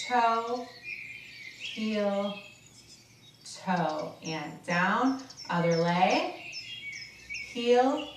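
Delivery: soothing fitness coach; calm exercise instruction. Acted. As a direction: guide them through the stretches calmly. Leads a guided stretch.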